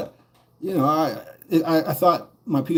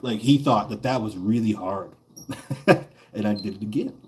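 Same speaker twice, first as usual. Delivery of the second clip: ordinary talk, nothing marked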